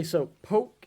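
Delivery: ordinary talk, nothing marked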